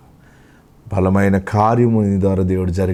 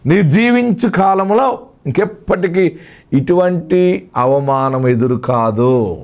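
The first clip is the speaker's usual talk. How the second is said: fervent proclamation; 5 semitones higher, slower than usual. Acted. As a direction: declare it over the grieving listener like a prophecy. Preacher proclaims the promise. (ఉద్వేగంగా ప్రకటన)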